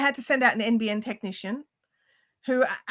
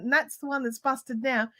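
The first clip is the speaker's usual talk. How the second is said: normal talking voice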